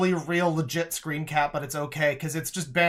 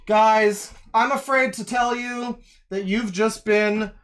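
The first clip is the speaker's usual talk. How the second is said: loud, pitched-up gloating shout; hammed-up gloating at the whole internet; mock triumphant yell; 2 semitones higher, 11 dB louder than usual. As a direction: ham up the kid's victory lap. Mocking the kid's triumphant claim.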